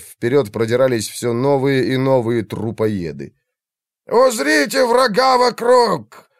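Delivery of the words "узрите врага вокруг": high, slow, booming command; thundering shout. Acted. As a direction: thunder it across the hill. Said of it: "Громом разнёсся по холму голос".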